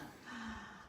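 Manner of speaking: a soft unvoiced shocked gasp. Reader acting Hare seeing Tortoise win, soft shocked gasp. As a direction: suck in breath in shock.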